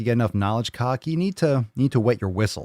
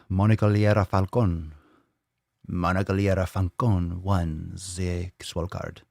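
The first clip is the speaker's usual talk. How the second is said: put-on Spanish accent; 3 semitones lower than usual. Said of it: "I don't know why I'm saying it like this".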